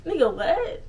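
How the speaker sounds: high laughing "what?" rising at the end; laughing disbelief. Real, not acted. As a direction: crack up mid-question in disbelief.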